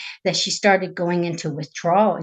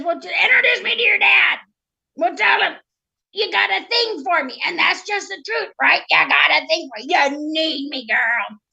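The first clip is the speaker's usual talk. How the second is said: higher-pitched frog sweet-talk; cocky, persuasive frog; 7 semitones higher than usual. Acted. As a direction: wheedle like a frog sure of himself.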